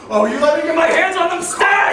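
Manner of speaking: fast, high, full-volume shouting; furious threat. Acted. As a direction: rage as if being held back. Furious, threatening to grab them.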